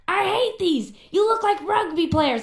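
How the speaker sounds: high, loud mock of a blunt New York label boss, slowing down; gruff old-school boss. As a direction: lampoon the old-school manager's contempt. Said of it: "he's got this strong, thick New York accent".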